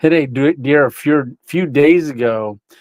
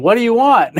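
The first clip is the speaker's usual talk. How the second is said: raised, higher demand through the glass; calling out; 8 semitones higher than usual. Retold or imitated. Asking the window knocker again, raised higher call. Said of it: "And I'm going".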